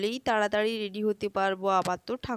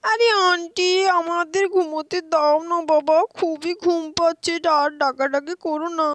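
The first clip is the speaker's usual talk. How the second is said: put-on higher, louder child's whine of protest; 7 semitones higher, 8 dB louder than usual. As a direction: plead to be left asleep. Voices sleepy children protesting. (ঘুমকাতুরে বাচ্চার আবদারের সুর)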